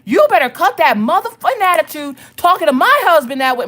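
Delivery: high, loud furious telling-off; acted-out outraged mom yell. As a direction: explode at a mouthy son. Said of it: "I'm going to yell at you".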